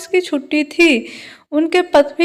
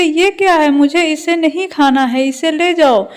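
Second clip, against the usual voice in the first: plain reading voice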